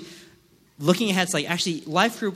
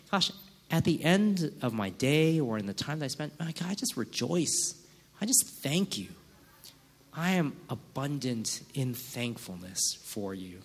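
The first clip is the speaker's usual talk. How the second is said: softer, hushed rejoicing; soft thankful prayer; 3 semitones lower, 6 dB quieter than usual. Pretend. A believer softly thanking God at day's end. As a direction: pray it quietly and tenderly.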